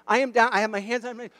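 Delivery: ordinary delivery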